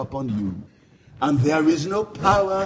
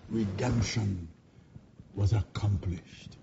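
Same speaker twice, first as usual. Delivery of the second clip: dropped to a soft, low hush; 7 semitones lower, 7 dB quieter than usual. Acted. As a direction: let the room fall silent with him. Hush after the climax.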